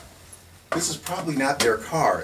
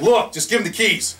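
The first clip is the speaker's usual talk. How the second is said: mock officer yell; 10 semitones higher, 6 dB louder than usual. Officer ordering him.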